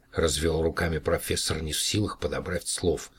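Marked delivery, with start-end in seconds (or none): none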